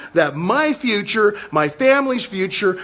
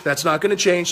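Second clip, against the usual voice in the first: ordinary talk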